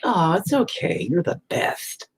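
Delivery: high, slow mock comforting voice; sugary mock consolation; mock reassurance. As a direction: mimic fake sympathy sweetly. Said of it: "where it's like".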